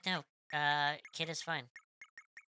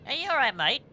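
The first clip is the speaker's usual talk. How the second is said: high, lilting attempt at an Australian accent; 10 semitones higher, 6 dB louder than usual. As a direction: greet them in a rough Australian accent. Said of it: "if I could do an Elstarian accent".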